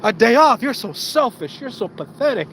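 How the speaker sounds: high, outraged mock employer; scornful boss. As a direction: act appalled by the worker's request. Boss scorns worker's request.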